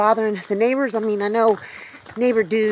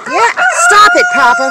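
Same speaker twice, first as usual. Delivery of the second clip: loud, very high command to a dog; scolding call; 11 dB louder than usual. Real, not acted. Owner telling Papa to stop, high loud scolding.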